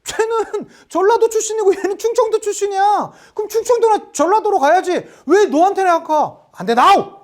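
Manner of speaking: high-pitched indignant arguing; acted vehement refusal; forceful protest. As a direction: object hotly as an outraged negotiator. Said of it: "아주 강력하게 주장을 합니다".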